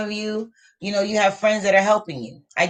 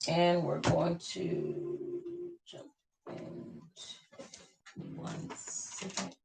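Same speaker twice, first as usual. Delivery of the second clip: murmured aside; 12 dB quieter, much slower than usual. Real, not acted. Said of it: "softly stepping away".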